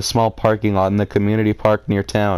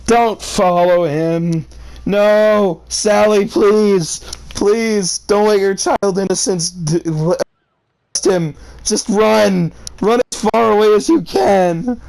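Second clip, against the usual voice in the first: frantic pleading shout; 9 semitones higher, slower than usual. Real, not acted. Reader begging the girl in the story to run, frantic high shout. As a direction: yell at her to get away.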